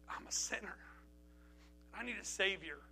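whispered, halting prayer; soft, reverent whisper with a long pause; hushed confession. Retold or imitated. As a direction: confess it softly to God, barely voiced. Re-enacting his prayer to Jesus.

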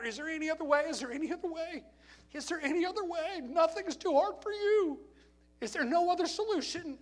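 high, pleading cry to a father; calling out in anguish. Retold or imitated. Jesus begging his Father for another way, high anguished plea. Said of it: "He's calling out to his father".

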